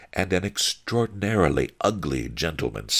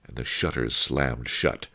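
usual speaking voice

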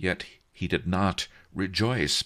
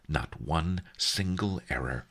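plain reading voice

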